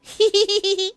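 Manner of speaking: high, loud tittering giggle; gleeful giggle. Real, not acted. Creature delighted by hot potato, giggling. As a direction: titter in a high squeal of glee.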